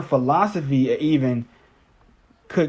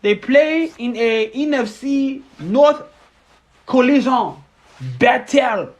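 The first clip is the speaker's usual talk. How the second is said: hammed-up French accent, higher and slower; over-the-top fake French accent; mock French accent; 8 semitones higher, slower than usual. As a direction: play a bad French sportscaster. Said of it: "I don't know if I'm French or, or German now".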